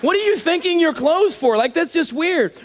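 rising to a shout of disbelief; incredulous outburst. Acted. Incredulous rant about thanking clothes.

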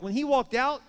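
ordinary delivery

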